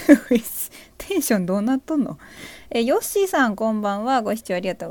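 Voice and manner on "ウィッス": chuckling through a loud "wissu"; laughing greeting. Real, not acted. Streamer laughing back at a viewer's greeting, loud and amused.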